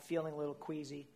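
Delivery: ordinary delivery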